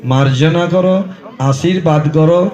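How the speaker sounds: slow Sanskrit hymn easing into a humble plea for forgiveness; prayer to Ganga. Acted. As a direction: pray to mother Ganga, then beg pardon.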